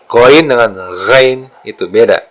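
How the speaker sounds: normal talking voice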